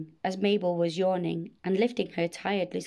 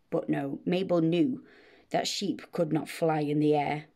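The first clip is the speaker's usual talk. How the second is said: usual speaking voice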